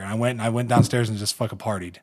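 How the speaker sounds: ordinary talk, nothing marked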